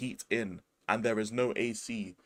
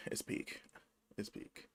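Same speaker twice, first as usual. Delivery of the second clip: whispered twice, voiceless; a mock-grim whispered verdict; 2 semitones lower, 17 dB quieter, slower than usual. Real, not acted. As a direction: breathe the words, no voice.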